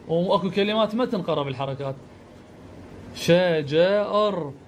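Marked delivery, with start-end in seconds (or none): 3.2-4.5 "ش، ج، ار" in drawn-out pieces; sounding out. Shown as a demonstration. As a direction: sound out "ش، ج، ار" one syllable at a time. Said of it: "ألفظه".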